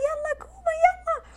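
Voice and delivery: high, loud, hurried urging; imitating her sister's high urging. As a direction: hurry her up off the floor. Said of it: "وأختي معي غايدة".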